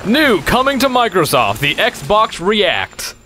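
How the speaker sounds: high, big commercial-announcer voice; mock advert announcer. Player improvises a console advert, hammed-up announcer. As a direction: sell it, stretching the product name.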